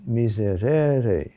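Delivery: miserere slow, rising to RE, then falling away. Shown as a demonstration. Teacher showing natural intonation of the word, miserere lifted on RE. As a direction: draw out miserere, lifting the third syllable above the others. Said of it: "accent is on a higher note".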